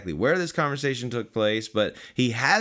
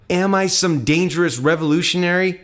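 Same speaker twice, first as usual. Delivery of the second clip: raised-pitch rhetorical question; indignant challenge; 6 semitones higher, 7 dB louder than usual. Acted. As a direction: challenge the arresting crowd, pitch lifted. Jesus challenges those arresting him.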